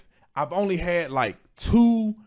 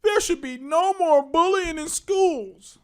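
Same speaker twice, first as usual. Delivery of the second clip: mocking quote; 11 semitones higher, slower than usual. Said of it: "if you say".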